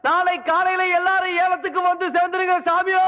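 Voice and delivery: town crier shout. Acted. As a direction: call it out across the village. Village crier announces fish auction. (ஊர் அறிவிப்பு கூவல்)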